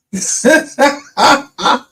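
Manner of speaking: high, loud burst of laughter. Real, not acted. Streamer laughing at his own story, loud burst.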